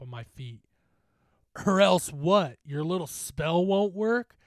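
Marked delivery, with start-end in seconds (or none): 1.6-4.3 loud, high-pitched jeer; mocking challenge. Bully scoffing at a teammate's threat, mocking. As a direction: throw it back as a scornful dare.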